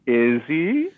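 drawn-out, high rising question; needling repeat, loud and swooping up; teasing doubt. Pretend. Co-host teasing about Deckard being human, drawn-out doubt. Repeats the tease after laughter.

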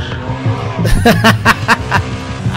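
breathy burst of laughter. Real, not acted. Host cracks up at his own joke, laughing.

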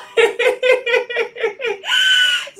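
high, loud shrieking laugh; joyful laugh. Real, not acted. Leader laughing with joy at her deck. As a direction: laugh out with delight.

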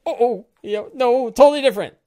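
hammed-up fake denial rising to a squeak; sarcastic denial. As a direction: pretend it's nothing like it, obviously lying. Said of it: "And I was like".

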